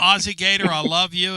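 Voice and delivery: loud, high, beaming call to the caller; gushing shout-out. Real, not acted. Host hails the Aussie caller with a loud, gushing shout-out. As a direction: greet him like a beloved hero.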